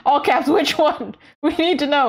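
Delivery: giggly all-caps demand; laughing. Real, not acted. Laughing about viewers' opinions.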